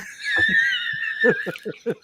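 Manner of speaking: helpless high laugh; high shrieking laugh. Real, not acted. Big laugh after the quote.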